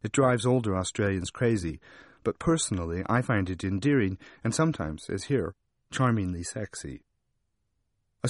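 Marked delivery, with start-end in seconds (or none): none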